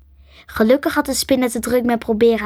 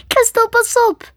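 high-pitched urgent shout; screamed warning; 13 semitones higher, 4 dB louder than usual. A narrator's reading. Ellen warning Crystal of the spider, screaming. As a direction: scream a warning just in time. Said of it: "Ze kon nog net schreeuwen".

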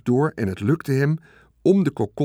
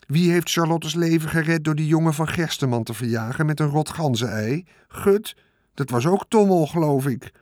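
higher, boastful sarcastic rat voice; a rat listing his good deeds smugly; 4 semitones higher than usual. Acted. The rat reminding Wilbur of his deeds, smug sarcasm.

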